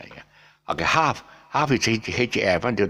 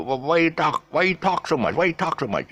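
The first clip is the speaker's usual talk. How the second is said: higher mock-American "why you talk so much"; mock American style; 11 semitones higher than usual. Shown as a demonstration. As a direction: put on American "tahk", pitched up. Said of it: "American style".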